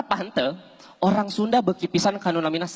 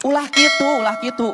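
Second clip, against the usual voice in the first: a sudden loud, higher "don't do that"; loud scold; 4 semitones higher, 4 dB louder than usual. Real, not acted. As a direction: shut the idea down with a burst of volume. Scolding the audience. (ngagorowok nyarékan)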